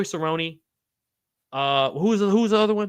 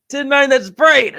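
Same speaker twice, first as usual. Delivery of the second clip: strained, high choking laughter; 7 semitones higher, 10 dB louder, slower than usual. Real, not acted. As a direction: laugh through the coughing.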